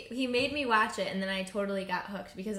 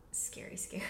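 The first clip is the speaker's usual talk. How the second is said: whispered, hushed, softer; whispering; 11 dB quieter than usual. Retold or imitated. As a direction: breathe it like a secret. Retelling parents' verdict.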